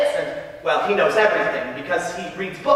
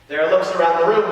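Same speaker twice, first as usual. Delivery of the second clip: normal talking voice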